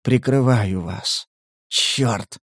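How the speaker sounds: slow, faint, effortful speech; weakening voice. A narrator's reading. Wounded scout to his captors, weakening voice. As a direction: labour the words as strength drains. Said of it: "незнакомец слабеющим голосом".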